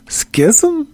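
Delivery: Schism? whispered on a high rising question; whispered high query. Real, not acted. Whispered puzzled try at word.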